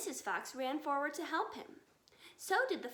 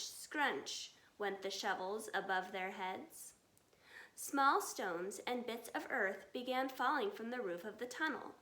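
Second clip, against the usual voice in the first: usual speaking voice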